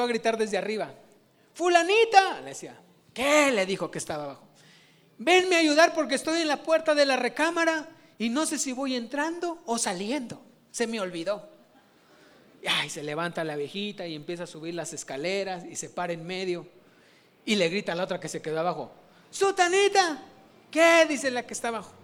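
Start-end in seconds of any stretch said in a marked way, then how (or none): 1.5-2.4 calling out. Acted. Old woman calls from upstairs. 3.1-3.5 calling back. Acted. Sister answers from below. 5.2-11.5 put-on confused old woman. Confused old woman voiced in joke. 19.3-20.2 high calling shout. Acted. Old woman on the stairs calling her sister, high yell. 20.7-21.1 loud, high "¿Qué?"; loud shout back. Acted. Sister downstairs answering the call, loud shout.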